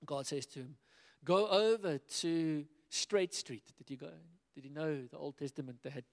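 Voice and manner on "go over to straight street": slow divine command. Acted. God's command read aloud.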